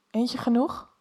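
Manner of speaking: plain reading voice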